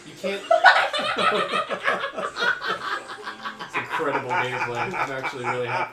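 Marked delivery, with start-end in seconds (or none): 0.5-3.6 high, loud burst of laughter. Real, not acted. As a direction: cackle at the screen. Laughing at the revive.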